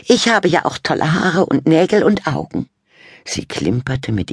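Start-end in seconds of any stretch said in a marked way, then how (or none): 0.1-2.7 preen over yourself; vain Fabienne. A narrator's reading. Fabienne boasting.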